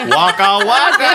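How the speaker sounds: Fozzie Bear voice. Pretend.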